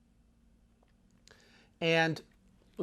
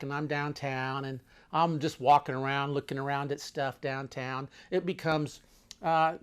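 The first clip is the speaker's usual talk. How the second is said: usual speaking voice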